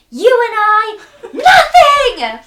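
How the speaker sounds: high, very loud dramatic yell; acted furious outburst. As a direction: shout it like a break-up scene. Acting out the break-up scene.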